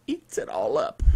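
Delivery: whispered like a hungry monster; spooky whisper. Pretend. Host scaring listeners about the exploit, in a creepy whisper. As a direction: breathe it out as a creepy secret.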